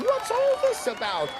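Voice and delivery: very high, excited call; shouting crowd. A narrator's reading. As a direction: shout it as an amazed onlooker. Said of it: "the people came shouting".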